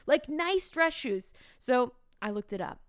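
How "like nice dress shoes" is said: higher, slow explaining student voice; mocking kids. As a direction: drawl it like a know-it-all kid. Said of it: "They're like".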